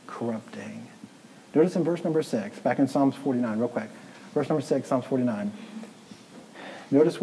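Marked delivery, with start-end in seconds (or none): 0.1-0.9 hushed whisper on the last word. Real, not acted. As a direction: drop to a whisper on corrupting. Sermon climax word.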